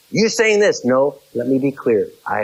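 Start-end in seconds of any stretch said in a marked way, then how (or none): 0.1-0.9 high mock voice of the other side; mocking the listener. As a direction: quote the misreading in a raised voice. Mock misunderstanding of speaker.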